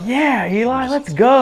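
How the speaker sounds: high-pitched hyped-up cheer; mock cheering. Acting out cheering a growth spurt.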